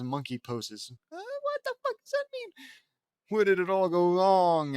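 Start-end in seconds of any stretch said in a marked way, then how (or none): 1.1-2.5 very high squeaky confused voice; baffled squeak. Acted. Baffled in put-on voice. 3.3-4.8 higher, louder despairing cry; dramatic lament. Acted. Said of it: "asks Lincoln".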